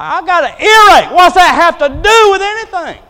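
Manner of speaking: loud, high, fed-up mock complaint; exasperated retort. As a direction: throw it back, irritated and loud. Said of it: "I'm like".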